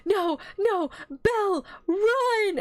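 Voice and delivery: high, drawn-out mock wail; desperate warning cry. Friend warns Belle to flee the centaur, mock desperate cry.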